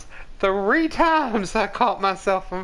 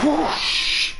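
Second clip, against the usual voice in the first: whoosh sound; 7 semitones higher than usual. Retold or imitated. Imitating the explosion.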